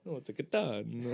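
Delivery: put-on laughing Italian-accented katana. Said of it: "in a very Italian accent".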